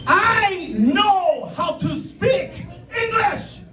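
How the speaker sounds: high, slow, furious yell of a grandma; enraged grandmother shout. Retold or imitated. Grandmother yelling back at her grandson in rage. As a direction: scream it like a furious old woman. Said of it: "this rage I've never seen this old woman have".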